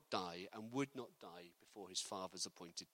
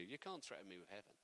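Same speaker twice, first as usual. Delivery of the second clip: ordinary talk, nothing marked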